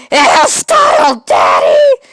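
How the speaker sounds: put-on excited kids yelling at their dad; kids shouting.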